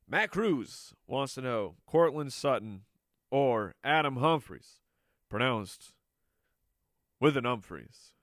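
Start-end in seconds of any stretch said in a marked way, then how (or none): 3.3-8.1 teasing pause before "pronounced"; milk the pause before the name for suspense; dramatic pause. Shown as a demonstration. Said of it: "that dramatic pause I put in there".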